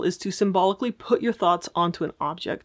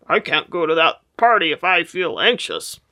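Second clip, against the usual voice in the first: weird voice; 4 dB louder than usual. Shown as a demonstration. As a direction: say the fear in a goofy voice. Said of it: "you start to use weird voices".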